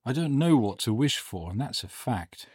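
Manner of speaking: lightly voiced, musing old man; a touch of slow deliberation; slowly. A narrator's reading. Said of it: "he said slowly".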